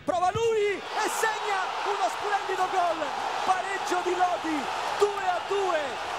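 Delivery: goal commentary shout. Real, not acted. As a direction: belt out the goal.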